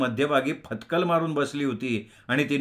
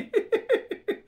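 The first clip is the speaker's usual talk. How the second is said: can't hold the laugh in; high breathless giggle. A narrator's reading. The girl bursts into giggles again in front of Anna. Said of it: "ती पुन्हा हसायला लागली".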